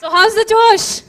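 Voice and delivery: shouted crowd call; rally the crowd loudly; loud hype call. Real, not acted. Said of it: "let me see how many of you are awake".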